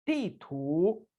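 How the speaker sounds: 地图 said with clear Mandarin tones; teacher demo of 地图; careful Mandarin model. Shown as a demonstration. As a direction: model 地图 for learners. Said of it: "इसकी practice करेंगे".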